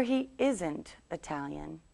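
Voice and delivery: "isn't" joined as one word; contracted negative. Shown as a demonstration. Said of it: "two ways to make this one shorter".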